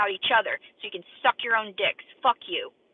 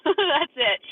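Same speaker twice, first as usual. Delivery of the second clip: laughing; 7 semitones higher, 9 dB louder than usual. Real, not acted. Laughs after one-star threat.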